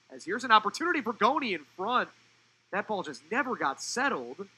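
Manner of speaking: excited commentary that eases as the chance fades; live play-by-play. Real, not acted. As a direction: call a scoring chance as it unfolds.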